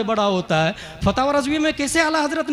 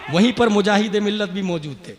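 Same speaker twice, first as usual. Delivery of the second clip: ordinary delivery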